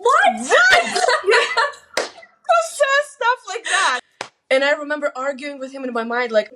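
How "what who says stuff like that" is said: incredulous laugh. Real, not acted. Laughing disbelief at the answer.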